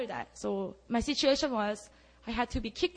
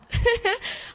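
short high burst of laughter; 13 semitones higher, 6 dB louder than usual. Real, not acted. Laughing at herself while telling the congregation. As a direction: laugh at her own outburst. Laughing at her own outburst.

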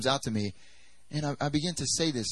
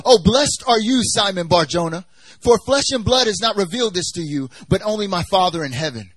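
loud, raised preaching declaration; emphatic blessing; 4 semitones higher, 7 dB louder than usual. Acted. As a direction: pronounce the blessing with force. Preacher voices Jesus praising Simon.

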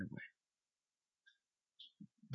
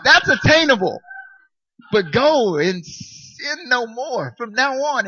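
mock whine; 9 semitones higher, 9 dB louder, slower than usual. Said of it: "I'm like".

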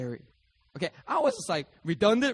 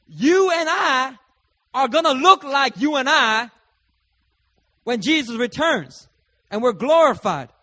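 much higher and louder, slow proclaiming preach; preaching at full force; 8 semitones higher, 10 dB louder than usual. Real, not acted. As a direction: proclaim it to the whole church, slow and big. Preacher builds to climax.

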